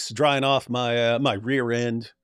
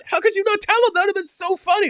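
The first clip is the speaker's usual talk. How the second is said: cellmate's disbelief. Retold or imitated.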